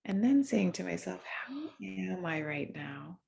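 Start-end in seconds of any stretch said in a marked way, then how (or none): none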